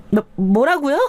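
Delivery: 말을 더듬으며 놀라 되묻는 아내 목소리; 당황해서 더듬기. A narrator's reading. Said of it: "아내는 당황해서 말을 더듬기 시작했습니다". (당황해서 높은 목소리로 말을 더듬음)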